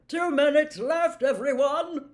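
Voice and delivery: loud, high hearty call. Acted. Slughorn calling out to the class, loud and high. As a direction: call across the whole dungeon. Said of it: "Slughorn called".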